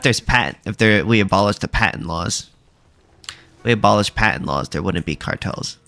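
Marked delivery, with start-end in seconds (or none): none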